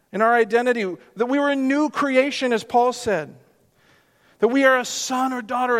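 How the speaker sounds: high, building sermon climax; rising pulpit fervour; impassioned preaching. Acted. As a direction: lift the room with each question.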